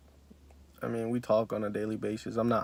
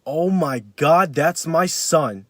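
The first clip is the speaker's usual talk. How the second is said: amazed recognition; 5 semitones higher, 7 dB louder, much slower than usual. Retold or imitated. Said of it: "And he was like".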